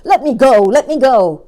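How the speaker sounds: a dangling crocodile kicking up a fuss; angry yell. A narrator's reading. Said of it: "yelled the enormous crocodile".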